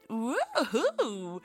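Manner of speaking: high, loud playful whoop; hammed-up cheer; mock excited cheer. Cheering the sexy aliens' arrival.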